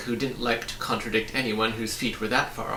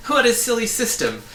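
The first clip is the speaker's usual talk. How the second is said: amused; 11 semitones higher, 7 dB louder than usual. A narrator's reading. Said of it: "the boy laughed".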